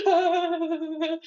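high-pitched fake wail with laughter; mock crying. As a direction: imitate crying over makeup, half laughing. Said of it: "just cry putting on makeup".

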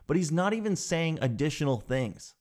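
ordinary talk

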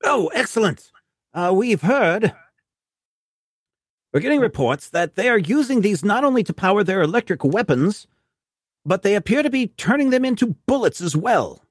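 high, hearty officer voice; Brigadier voice. Acted. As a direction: greet the agent like an old commander.

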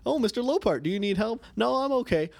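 high, lightly voiced cartoon exchange; chirpy kids'-show back and forth; mock dialogue. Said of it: "in every freaking episode of Handy Manny".

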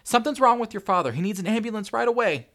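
fast, high, loud panic; frantic yell. A narrator's reading. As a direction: urgently shout for an ambulance. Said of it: "Tanya yelled frantically".

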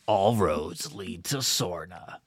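low, slow, drawn-out movie tough guy; Dom Toretto. Acted. Host joking as an action-film hero arriving, low slow impression. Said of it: "it's like Dom Toretto".

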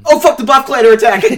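loud laughing mock panic; hammed-up battle alarm, half laughing. Pilot announcing the Buff Clan attack, loud mock panic.

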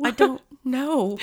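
slow, high fake sob; phony crying. Retelling the confused hungry guest, phony crying. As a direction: whine it out as mock weeping. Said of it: "phony baloney crying".

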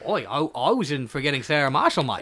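put-on Aussie Russell Brand; lightly done Australian accent; mock Australian. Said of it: "Australian Russell Brand".

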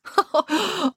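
short high character laugh; acted laugh. A narrator's reading. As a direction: give a quick, high laugh. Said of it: "Loretta laughed".

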